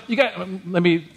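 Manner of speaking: ordinary talk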